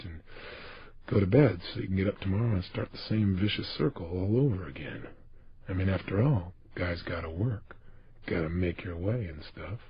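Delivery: low, very quiet, listless mumble; weary working person. Acted. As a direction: make tired excuses, low and without energy. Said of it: "most people are barely making it through the day".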